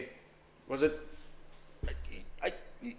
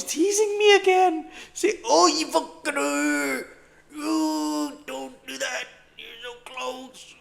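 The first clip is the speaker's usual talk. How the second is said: high pleading; 8 semitones higher, 4 dB louder than usual. Real, not acted. Trader pleads with the market.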